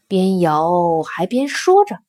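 ordinary delivery

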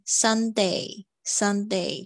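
"Sunday" said very slowly ending on a clear A; teacher slowly modelling "Sunday"; slow model pronunciation. Shown as a demonstration. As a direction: drag out the "day" in "Sunday". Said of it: "也是发A的声音".

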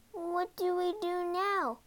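put-on high, anxious girl's voice; shivering. A narrator's reading. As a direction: ask fretfully, as if shivering.

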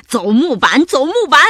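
loud chanted pirate yell, rising; shouting. A narrator's reading. Two pirates sending the monster to the plank, shouting. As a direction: chant it at the prisoner. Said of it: "条纹裤本克和黑眼罩帕西大喊道".

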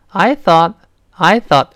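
I thought with an open ah vowel, high and loud; American thought. Shown as a demonstration. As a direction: say I thought as I thaht, American style. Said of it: "充满了美式的特色". (美式读I thought，元音张开成“啊”)

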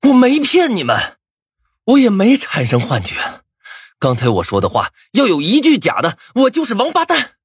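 high, desperate outburst; agitated roar. A narrator's reading. Student swearing to disbelieving classmates, agitated roar. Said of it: "红着眼吼道".

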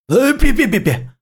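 high, panicked pleading that drops off; begging for mercy. A narrator's reading. Captured lord begs the general not to behead him. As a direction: plead for your life before the axe falls. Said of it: "萧齐求饶".

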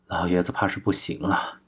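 soft, stunned murmur of bad news; dazed. A narrator's reading. Dazed pupil admitting the old master may not make it, stunned and soft. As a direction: let it out softly, in a daze. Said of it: "怔怔地抬起头说道".

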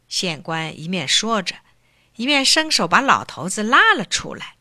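usual speaking voice

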